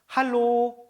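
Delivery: 할로 said as a bright German hallo, ha-lo. Shown as a demonstration. Teacher to students, modelling 할로 clearly. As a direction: model 할로 for the class. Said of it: "요런 표현 씁니다".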